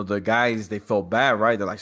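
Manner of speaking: usual speaking voice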